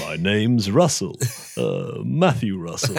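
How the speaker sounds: slow, lightly voiced suave Bond; a hint of a suave Bond hero; Roger Moore impression. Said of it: "that was, uh, Roger Moore".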